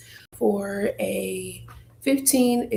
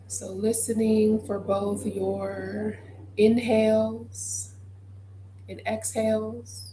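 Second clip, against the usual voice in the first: guided meditation; 6 dB quieter than usual. Acted. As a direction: guide listeners gently through their breath, leaving space. Leads a breathing meditation.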